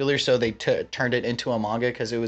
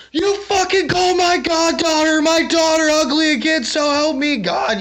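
over-the-top screaming threat; furious threat; 6 dB louder than usual. Retold or imitated. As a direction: bellow a threat like an enraged anime hero. Bakugo threatens the teacher.

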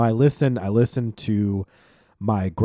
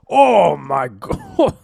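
retold elated exclamation; 9 semitones higher, 7 dB louder, much slower than usual. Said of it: "He, like, slaps the table".

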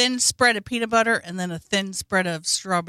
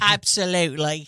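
put-on Australian accent; 3 semitones lower than usual. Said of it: "with the, uh, Australian accent".

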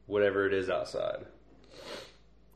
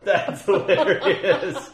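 high, loud and drawn out through laughter; real laughing fit; 8 semitones higher, 7 dB louder, slower than usual. Real, not acted. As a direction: barely get it out for laughing. Cracking up at the joke.

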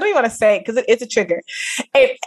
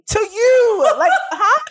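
laughing disbelief; 11 semitones higher, much slower than usual. Real, not acted.

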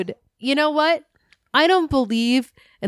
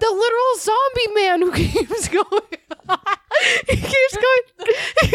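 high, helpless laughing speech; real giggling fit breaking into the words; cracking up; 9 semitones higher than usual. Real, not acted. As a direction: try to get it out through laughter. Cracking up mid-rant about royal.

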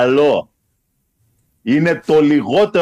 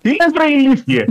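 1 mock outraged; 13 semitones higher, 3 dB louder than usual.

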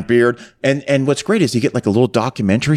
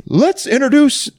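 mock announcer; 13 semitones higher than usual. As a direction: ham up a booming MC introducing finalists. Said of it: "He goes".

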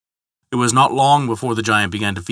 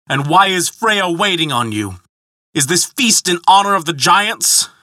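higher-pitched, indignant questioning; demanding; 7 semitones higher than usual. A narrator's reading. Thor challenging the giant's welcome, demanding. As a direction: press him for answers, mildly angry. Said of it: "demanded Thor".

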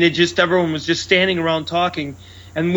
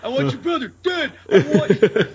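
Robert De Niro; 6 semitones higher than usual. Acted. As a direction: play along with a hammed-up mobster rage. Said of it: "you're like Robert De Niro in The Untouchables".

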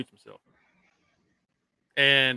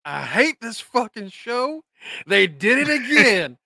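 slow, higher mock despair; quoting Kenny; 7 semitones higher, 4 dB louder, slower than usual. Co-host quotes Kenny's text in slow mock despair. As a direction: drag out a fan's exasperation. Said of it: "I quote Kenny".

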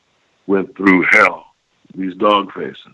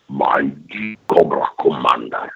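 deep, much lower Vader-like boom on Cobra Commander; James Earl Jones voice; 7 semitones lower than usual. Shown as a demonstration. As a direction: intone it low and resonant like Darth Vader. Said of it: "What I did borrow from James Earl Jones".

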